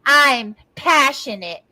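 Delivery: mimicking someone with a plugged nose; nasal mocking voice. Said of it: "She fucking plugs her nose".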